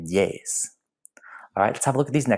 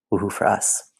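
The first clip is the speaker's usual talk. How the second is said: whisper. Real, not acted. Whispered mock cheer to class.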